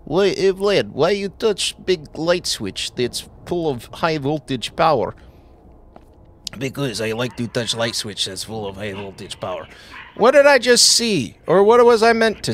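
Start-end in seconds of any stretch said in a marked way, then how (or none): 0.0-5.1 lightly put-on Russian accent asking Vlad; thick Eastern European accent, broken grammar; mock Russian accent. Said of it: "He's like". 6.6-9.7 put-on quick, low, deadpan Russian accent reply; mock Russian accent. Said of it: "He's like".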